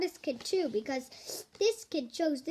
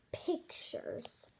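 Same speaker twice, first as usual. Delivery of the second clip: whispered; 11 dB quieter than usual. Acted. Dramatic whispered title word.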